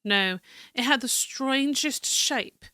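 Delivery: American attempting a refined British accent; fancy British accent. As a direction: read the journal in fancy British English. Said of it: "Fancy British".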